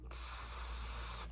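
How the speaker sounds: "f" said wrongly as a long "th"; tongue out, blowing a soft "th" for "f"; voiceless "th" held in place of "f". Shown as a demonstration. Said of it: "and put my tongue out".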